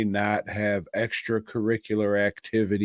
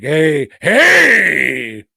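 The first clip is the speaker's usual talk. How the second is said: loud, higher, dragged-out gangster boss; Al Pacino impression; 6 semitones higher, 10 dB louder, slower than usual. Al Pacino's character offering him a job, loud hammy impression. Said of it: "And Al Pacino's like".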